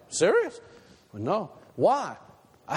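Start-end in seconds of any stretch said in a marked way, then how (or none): 0.1-0.6 high, rising disbelieving question; a hint of shocked disbelief; incredulous. Crowd doubting the lady, high and incredulous. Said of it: "Everybody's like".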